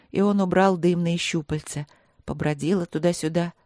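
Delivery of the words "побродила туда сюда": ordinary delivery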